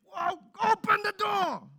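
high, loud angry father yelling; Ezra's shout. Retold or imitated. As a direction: bellow it like a fed-up dad. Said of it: "I'm trying to sound like Ezra".

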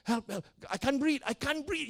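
high, strained plea without volume; retold cry for help. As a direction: re-enact choked cries for help, pitched high. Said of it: "And I was calling".

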